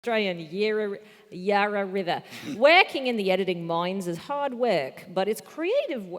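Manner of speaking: careful put-on Australian accent; pseudo-Australian accent. Shown as a demonstration. Comedian reading a bad sentence in pseudo-Australian accent. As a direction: attempt Australian, cue line first. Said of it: "pseudo-Australian accent".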